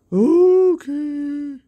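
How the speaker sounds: high loud ghostly okay; meek ghost. Acted. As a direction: give in sheepishly. Ghost agrees.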